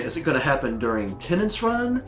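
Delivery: ordinary talk, nothing marked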